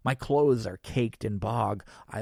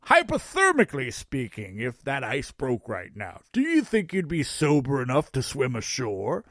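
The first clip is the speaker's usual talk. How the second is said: Santa voice; 4 dB louder than usual. Acted. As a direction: play a cheerful Santa. Santa speaks.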